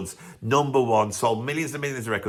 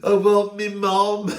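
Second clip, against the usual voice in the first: slow, pleading Sheffield cry, louder and higher; mock Sheffield accent; 6 semitones higher, 4 dB louder, slower than usual. Drummer after the crash, in a hammed-up Sheffield plea. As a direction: wail it like a dazed Sheffield lad. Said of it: "exactly what you would say".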